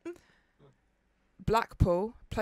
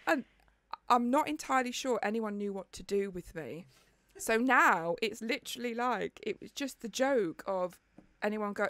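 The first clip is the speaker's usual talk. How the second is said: ordinary talk, nothing marked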